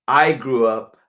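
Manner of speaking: ordinary delivery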